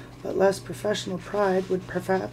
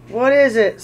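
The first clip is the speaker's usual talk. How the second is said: loud, higher troll voice; loud troll question; 4 semitones higher, 9 dB louder than usual. Acted. The other trolls to William, a loud question. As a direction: shout the question across.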